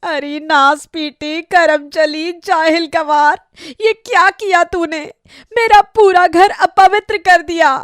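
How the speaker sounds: high, wailing horror at the sight; mother-in-law discovers her kitchen defiled, horrified and furious; shocked outrage. Acted. Mother finds hen roasted in kitchen.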